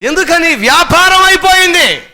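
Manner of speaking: high, loud denunciation; fiery preaching. Real, not acted. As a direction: hit it hard and high. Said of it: "preacher denounces hospitals". (ప్రసంగంలో ఎత్తైన, గట్టి స్వరంతో ఖండన)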